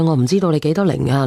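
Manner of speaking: usual speaking voice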